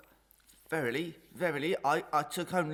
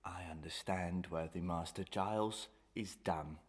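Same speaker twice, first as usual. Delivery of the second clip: low, softer whisper; 8 semitones lower, 6 dB quieter than usual. Acted. Servant whispering back his promise to stay silent. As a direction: answer in a hushed whisper.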